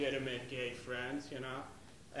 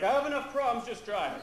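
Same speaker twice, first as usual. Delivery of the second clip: higher, louder snapping dad; irritated dad; 8 semitones higher, 9 dB louder than usual. Retold or imitated. Dad behind the wheel refusing requests, higher and louder. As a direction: snap back while driving. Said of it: "He's like".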